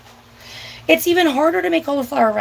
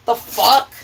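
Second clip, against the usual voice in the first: ordinary talk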